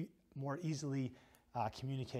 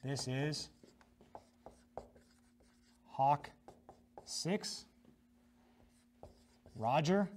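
slow spaced radio call; radio operator call; much slower than usual. Shown as a demonstration. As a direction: say each word clearly over the net. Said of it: "So then you go".

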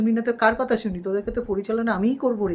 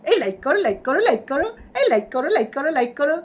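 excited plea; 4 semitones higher, 3 dB louder than usual. Real, not acted. Begging viewers to like.

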